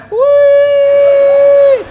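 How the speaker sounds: long high held whee; falling whee. Retold or imitated. Comedian mimicking the plunging plane, high whee. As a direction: ride the drop with a high wail.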